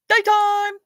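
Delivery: Daytime! cried high and loud; high loud cry. Pretend. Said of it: "it's the nighttime, daytime one".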